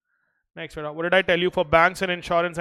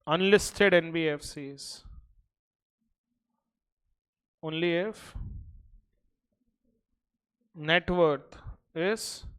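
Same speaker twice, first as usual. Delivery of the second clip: slow, even dictation pace. Acted. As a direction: read it out for notes. Dictates notes to class.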